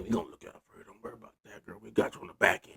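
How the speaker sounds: hushed, whispery promise; conspiratorial aside. Acted. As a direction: drop right down as if sealing a secret deal. Conspirators coaxing Delilah with promises.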